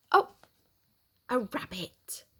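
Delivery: ordinary delivery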